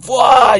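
loud, high startled yell; falling yelp. Acted. Judge falling out the window, startled high yell. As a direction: yelp as you topple backwards.

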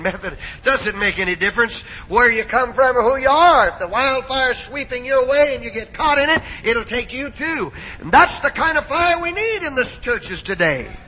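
emphatic pulpit exhortation, pitch climbing toward the end; fervent preaching. Real, not acted. Preacher telling the church wildfire takes everyone, fervent preaching. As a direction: sweep the congregation along with you.

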